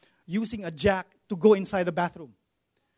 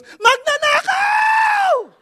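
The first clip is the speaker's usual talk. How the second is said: long high yelling cry of thief; shouting thief; 4 dB louder, much slower than usual. Retold or imitated. Mother yelling from the window to wake the neighbours, screaming. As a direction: scream it out the window at the top of your voice. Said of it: "Top of her voice".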